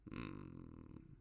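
low, soft monster hum; musing creature, deep and quiet; pondering slimy monster. A narrator's reading. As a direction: rumble a low, thoughtful hum.

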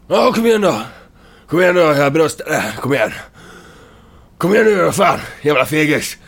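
a furious taunting shout. Acted. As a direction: yell at them to come out. Hero shouts challenge at enemies.

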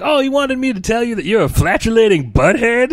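high, loud mock-sweet voice; cheeky insult. Booth guy passing on an insult, high and cheeky. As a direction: deliver the insult sing-song. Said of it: "he's like".

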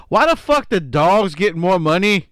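loud outraged shout. Real, not acted. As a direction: yell it in disbelief. Outraged rant.

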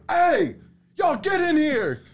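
high, loud summons; dad calling. Retold or imitated. Angry dad calls the kids in from the window. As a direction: bark the kids inside.

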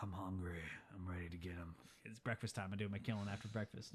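low, soft imitation of a gang leader; outlaw boss. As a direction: answer low and quiet as a villain. Gang boss answers his henchman.

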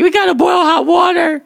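high-pitched imitation of a panicking roommate; mock panic. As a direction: wail it in comic alarm. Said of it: "He started panicking".

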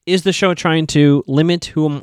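ordinary talk

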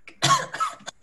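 sudden high cough; acted cough. Captive keeling over on the grass, acted coughing. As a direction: cough as if breaking down mid-plea.

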